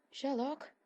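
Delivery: called up into the dark; calling out. A narrator's reading. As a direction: Jane calls for Sherlock in the warehouse, calling out. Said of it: "she calls out into the dimness".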